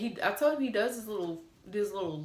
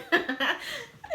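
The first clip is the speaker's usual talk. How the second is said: squealing laugh, rising; high laugh; 4 dB louder than usual. Real, not acted. Stuck imitating the licking emoji, high laugh.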